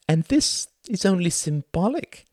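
high, showy stage-host voice; theatrical thin man. Acted. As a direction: play the pompous thin performer lecturing the audience. Thin man wipes swastika.

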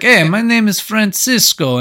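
high, hammed-up foreign accent; Francisco. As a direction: put on a silly high-pitched accent. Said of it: "his accent".

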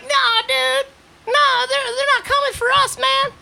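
mock high stoner buddy; Ron's stoner voice. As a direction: do your lazy friend way up high. Ron's voice in retold chat.

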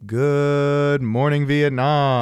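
long drawn-out, loud radio call; Robin Williams impression. Host explaining his intro bit, drawn-out Robin Williams call. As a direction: bellow it like a wartime radio DJ. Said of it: "Robin Williams with the iconic".

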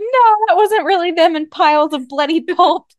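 high, loud teasing voice of disbelief; mock denial. Co-host teasing her friend's naive denial, high and loud. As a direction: tease her by playing her denial big.

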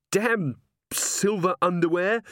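high, slow, aggrieved curse; pained cursing. A narrator's reading. Angua hurt by silver, cursing in pain. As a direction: grumble through the pain.

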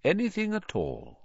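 tender, quiet invitation; gently. A narrator's reading. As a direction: gently leave the door open. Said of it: "he said gently".

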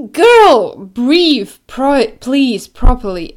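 loud exasperated plea; exasperated shout. Real, not acted. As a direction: yell at her to breathe. Exasperated at singer.

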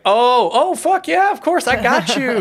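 high, loud burst of eagerness; over-the-top enthusiastic local offering help; eagerly helpful Chicagoan. Acted. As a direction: jump in, thrilled to help a lost stranger. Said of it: "they're like".

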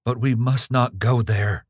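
high, loud frantic cry; half-screamed warning; desperate shout of a terrified young woman. Acted. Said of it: "she cried".

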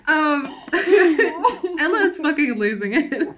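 giggling through the words; breaking up with laughter; laughing. Real, not acted. Helpless laughter.

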